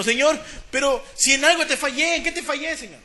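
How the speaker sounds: high-pitched imitation of a hedging sinner; mock protesting prayer. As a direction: imitate someone questioning God, voice climbing. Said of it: "no, vamos a decir eso". (imitación de una queja a Dios con voz aguda)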